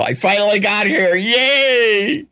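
high, drawn-out little cheer; playful celebration. Real, not acted. Host finally reaches his long-awaited topic, playful high cheer.

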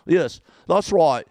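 exaggerated English put-on voice; mock English accent. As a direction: imitate an Englishman passing as a local. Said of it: "the English guy".

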